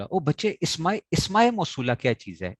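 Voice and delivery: high-pitched teacherly protest; incredulous correction. Acted. Teacher scolding pupils over mispronunciation.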